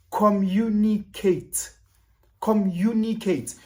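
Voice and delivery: drawn out, each word weighted; slow emphasis. Acted. Lecturing viewers, slow and pointed. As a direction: spell it out slowly. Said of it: "slow emphasis".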